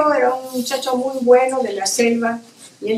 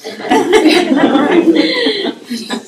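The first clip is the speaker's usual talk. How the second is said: real high burst of laughter; bright amused laugh; 6 semitones higher, 5 dB louder than usual. Real, not acted. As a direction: laugh out loud at the memory. Laughing at nickname.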